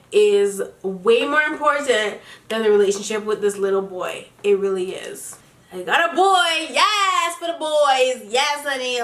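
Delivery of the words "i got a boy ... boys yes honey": high-pitched, delighted whoop; excited cheering. Real, not acted. Excited cheer over email from a boy.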